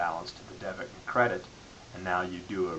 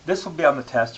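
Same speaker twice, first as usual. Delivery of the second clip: louder and higher, pressing the point home; loud emphatic warning; 6 semitones higher, 12 dB louder than usual. Real, not acted. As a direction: raise your voice to warn the class. Teacher warns class about exam.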